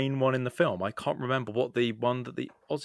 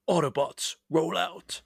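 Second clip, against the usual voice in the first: higher-pitched heroic robot catchphrase; Autobots battle call; 5 semitones higher, slower than usual. Acted. Contestant choosing his robot themed buzzer, Autobots battle call. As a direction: call it out like a rallying command. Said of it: "I'm gonna go with".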